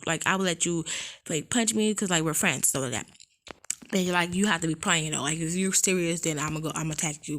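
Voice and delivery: normal talking voice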